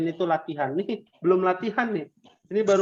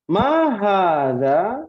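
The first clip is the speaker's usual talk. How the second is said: slow drill question; 3 semitones higher, 5 dB louder, much slower than usual. Shown as a demonstration. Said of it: "teacher drilling class".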